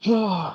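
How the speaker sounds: heavy, exasperated exhale; frustrated sigh. Acted. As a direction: act out a weary sigh. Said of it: "do it kind of like a".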